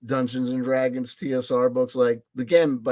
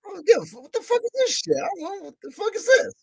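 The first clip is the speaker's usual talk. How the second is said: much higher, fast, mock-frantic swearing; hammed-up careless rant; faster than usual. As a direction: play your reckless shopping self, squeaky and giddy. Acted self-quote, careless buying.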